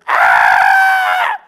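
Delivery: piercing high scream of terror; little boy screaming at a witch; terrified scream. Retold or imitated. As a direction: shriek it like a scared kid seeing a witch. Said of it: "I screamed to the top of my lungs".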